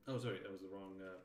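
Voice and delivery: ordinary delivery, nothing marked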